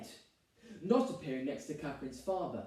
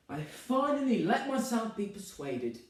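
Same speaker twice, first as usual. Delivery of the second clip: ordinary talk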